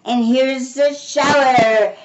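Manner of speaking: slow, high, loud announcement; shouting. A narrator's reading. El Kolu arrives with the hose, shouting. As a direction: shout it out, stretching the words. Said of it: "he shouted".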